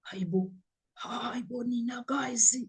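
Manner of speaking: hushed, stunned whisper of disbelief; shocked whisper. Real, not acted. Vlogger whispering her shock to viewers. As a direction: breathe it out in shock.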